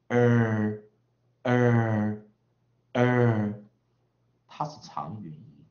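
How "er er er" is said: 呃 held long, loud and level each time; vowel sound demonstrated. Shown as a demonstration. Teacher showing how it is pronounced, 呃 as long loud "er". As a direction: sustain 呃 as a steady r-coloured "er", three times. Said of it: "如果发音为呃".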